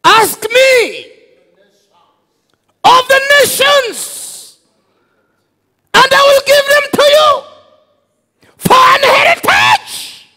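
high, loud, thundering scripture proclamation; full-force preaching. Acted. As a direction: declare the verse at the top of his voice.

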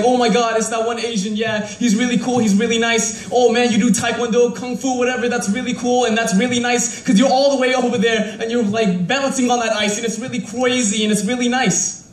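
high, gushing fan voice, lightly mocking; gushing admirer. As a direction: play the starstruck fan who thinks it's all cool. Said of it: "everybody's like".

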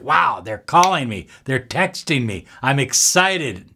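usual speaking voice

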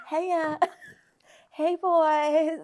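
giggling through a put-on sexy hey; can't keep a straight face doing it; laughing sexy voice. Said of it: "oh, I'm doing it now".

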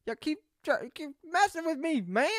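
high, loud Chris Tucker voice; mimicking [name] but sounding like Chris Tucker; Chris Tucker impression. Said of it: "Sound like Chris Tucker".

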